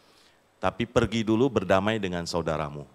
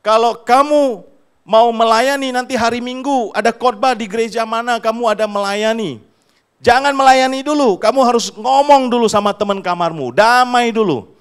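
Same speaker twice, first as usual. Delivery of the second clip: much higher and louder commanding voice; booming divine command, retold in a sermon; voice of God; 12 semitones higher, 9 dB louder than usual. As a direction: command it as God speaking to the servant. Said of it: "Tuhan bilang".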